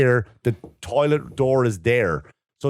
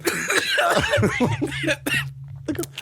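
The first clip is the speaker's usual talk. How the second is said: high cackling laugh. Real, not acted. Laughing at his own story.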